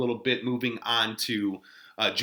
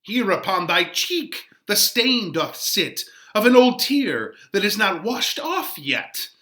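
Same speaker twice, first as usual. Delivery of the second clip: dramatic Shakespeare reading; 4 semitones higher, 5 dB louder than usual. Acted. Teacher reads Friar Laurence's lines to the class, dramatic verse reading. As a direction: read the verse aloud with heightened, louder stage delivery.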